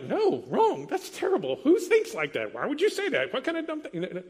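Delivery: indignant disbelief. Retold or imitated. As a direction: scoff in disbelief. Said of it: "And you're like".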